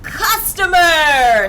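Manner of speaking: slow loud bellow. A narrator's reading. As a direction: bellow it out slowly at the customer. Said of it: "bellowing at you".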